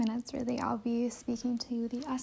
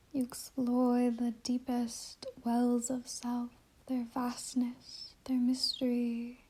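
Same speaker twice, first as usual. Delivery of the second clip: guided meditation. Acted. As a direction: guide listeners inward, unhurried, letting each phrase settle.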